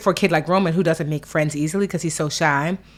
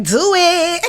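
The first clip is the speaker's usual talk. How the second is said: high, loud, laughing dare; sassy dare; 11 semitones higher, 4 dB louder than usual. Retold or imitated. A bold little girl daring her mom to spank her, cheeky.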